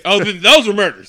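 high, very loud outburst; loud exclamation. Real, not acted. Co-host reacting to Tony's needless killings, exclaiming loudly. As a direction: burst out with the sudden realization.

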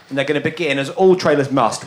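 usual speaking voice

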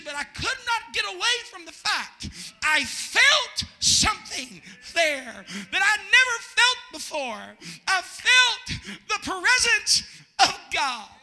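loud, slow, earnest retelling of mother's words; mother's testimony. Retold or imitated. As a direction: let each word land slowly. Mother's remembered testimony, preached.

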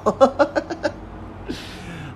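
high-pitched helpless giggle at his own joke; high giggle fit. Real, not acted. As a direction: crack up at yourself in a high giggle. Laughing at his own conspiracy rant.

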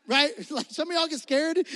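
ordinary delivery

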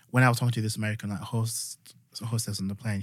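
ordinary delivery

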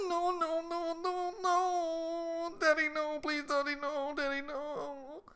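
put-on high, wailing child's plea; imitated panicked daughter. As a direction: beg in a put-on, high-pitched little kid wail. Voicing daughter panicking about going outside.

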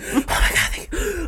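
whispered, airy mock gratitude; breathless mock thanks. Mocking a thank-you to the gym stranger, whispered. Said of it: "what do you want me to".

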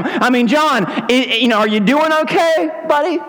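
high, needling busybody voice; rubbing it in. Acted. Townspeople remind John of his lost popularity, high-pitched needling. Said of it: "Rubbing it in, right?".

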